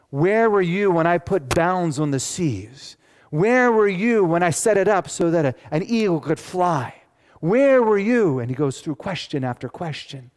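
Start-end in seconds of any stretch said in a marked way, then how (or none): 0.1-8.4 God questioning Job. Acted. God challenging Job from the storm. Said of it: "You know what God did?".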